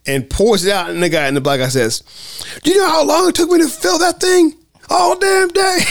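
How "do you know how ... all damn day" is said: high-pitched outraged mimicry; mock pissed-off shout. Hospital patient yelling after Leo empties his cup, pissed. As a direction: holler in a high, indignant put-on voice.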